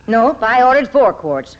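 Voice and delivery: usual speaking voice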